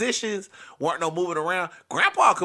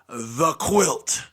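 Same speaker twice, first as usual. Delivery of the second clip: ominous reveal; 2 semitones lower, 3 dB louder than usual. Shown as a demonstration. Storyteller introducing the dreaded quilt, grave announcement. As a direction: set apart, heavy and deliberate. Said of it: "Why am I not saying it".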